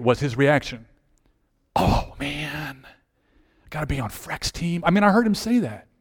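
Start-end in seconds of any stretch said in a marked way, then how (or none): 1.8-4.8 griping. Retold or imitated. Player picked by the unpopular captain, mock griping. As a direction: imitate a disgusted player complaining under his breath. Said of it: "just complaining, just griping".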